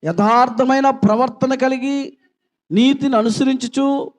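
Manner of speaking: slow, high, loud psalm reading; slow, ringing Bible reading; solemn scripture reading. Acted. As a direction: intone the psalm slowly to the church.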